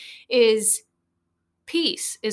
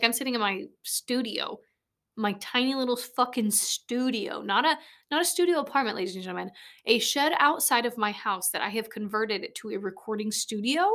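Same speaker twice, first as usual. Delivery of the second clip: ordinary talk, nothing marked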